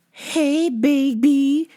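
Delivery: high, drawn-out cooing greeting; creepy stalker. Retold or imitated. Stalker sidling up to the worker, creepy high coo.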